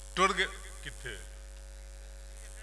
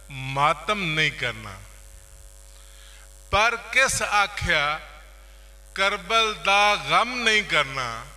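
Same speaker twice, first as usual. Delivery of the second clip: raised, projected mushaira recital, lines repeated with pauses; poetry recitation; 4 semitones higher, 7 dB louder than usual. Acted. Poet reciting Karbala verses to listeners, declaimed. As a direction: declaim each half-line, pausing before the next.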